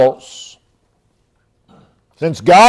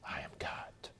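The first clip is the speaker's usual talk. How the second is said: soft breathy whisper of God's words; 33 dB quieter than usual. Pretend. Preacher whispers God's voice into the listener's ear. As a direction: breathe it like God speaking into your ear. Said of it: "Whether it be God whispering in our ear".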